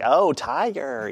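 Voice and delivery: slow, drawn-out "tiger" for tire, mimicking the other accent; mock pronunciation. Tennesseans echoing sister-in-law, "tiger" slow and exaggerated. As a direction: stretch "tiger" out in exaggerated imitation. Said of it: "And they're like".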